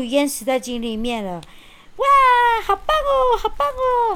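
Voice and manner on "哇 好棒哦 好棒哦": put-on high, gleeful child's cheer; happy cheering. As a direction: cheer like a delighted little girl. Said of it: "小红帽拍着手高兴地跳着".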